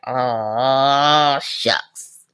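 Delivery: low breathy oh shucks. Retold or imitated. Mom watching son roll his eyes at grandma, low uh-oh. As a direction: drop low, sensing trouble.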